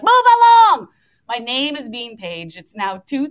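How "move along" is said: much higher, much louder impatient yell. Retold or imitated. Stuck behind a slow traveller at security, loud impatient yell. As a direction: blast it at the slow guy ahead. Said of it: "I'm like".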